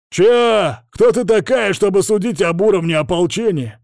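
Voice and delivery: high, loud indignant shout; yelling. Acted. Patrol leader confronting a critical stranger, outraged yell. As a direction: bellow an offended challenge. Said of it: "заорал на меня сквозь мифриловое забрало".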